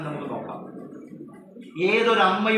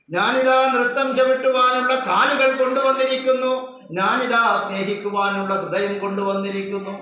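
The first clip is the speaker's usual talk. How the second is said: slow, rising proclamation, building louder; voicing the newborn; 5 dB louder, slower than usual. Speaker voicing the baby's message to parents, slow solemn declamation. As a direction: declaim it like an oath, line by line. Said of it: "കുഞ്ഞ് പറയുകയാണ്".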